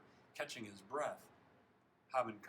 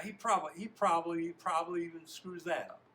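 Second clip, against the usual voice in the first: high, very loud scornful jeer; worked-up taunt; 6 semitones higher, 10 dB louder than usual. Shown as a demonstration. Reader retries the line as an upset teammate, jeering. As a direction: blurt it out, slurring "probably". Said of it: "in a state like that".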